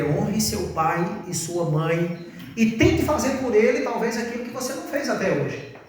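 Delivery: usual speaking voice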